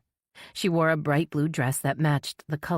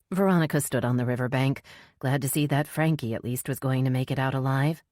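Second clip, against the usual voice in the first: plain reading voice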